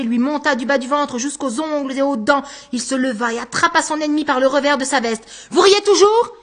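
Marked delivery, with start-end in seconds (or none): 5.5-6.4 loud and high, rising in rage; furious challenge. Acted. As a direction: spit it at him, enraged. Anguste grabs his enemy. (Anguste furieux, le saisissant au col)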